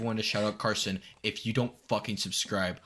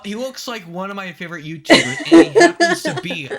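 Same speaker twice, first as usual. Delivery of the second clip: loud laughing gush; laughing shout; 8 semitones higher, 13 dB louder than usual. Real, not acted. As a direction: blurt it out laughing, much louder.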